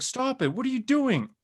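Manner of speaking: high-pitched exasperated bystander; mock protest. Onlookers objecting to Tony fighting a robot, mock protest.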